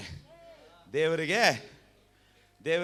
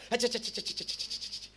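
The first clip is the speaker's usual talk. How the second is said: higher ಅಚ್ಚ ಚ್ಚ clicks fading into a whisper; mock disgusted clicking; 6 semitones higher, 9 dB quieter, faster than usual. Preacher acting out stepping in dung, disgusted tongue clicks fading. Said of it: "ಶೂಗೆ ಹಾಕ್ಬಿಟ್ಟು ಏನಂತೀರಾ?".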